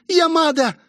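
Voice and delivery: имя выкрикнуто очень высоко; позови высоким криком; окрик. A narrator's reading. Демонессу окликают, высокий зов. (громкий зов)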